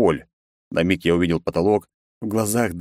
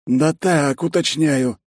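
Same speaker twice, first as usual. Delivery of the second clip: high-pitched, casual dodge; evasive secretary; 9 semitones higher, much slower than usual. A narrator's reading. Secretary excusing his prying question, high and evasive. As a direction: brush it off high and innocently. Ben's character voice.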